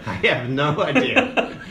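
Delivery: slow, helpless laughing answer. Real, not acted. GM answers the players, laughing. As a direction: get it out through real laughter. GM cracks up at the question.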